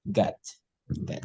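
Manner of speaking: "God" said alone, short open vowel; pronunciation demo. Shown as a demonstration. Teacher repeating how Tuhan is read, isolated "God". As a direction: repeat "God" as a separate model word. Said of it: "itu cara bacanya".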